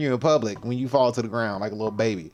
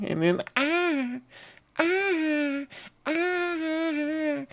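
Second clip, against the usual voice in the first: higher, drawn-out fake whimpers; mock whimpering; 5 dB quieter, much slower than usual. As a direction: ham up pathetic little cries. Mocks the arrested person's cries.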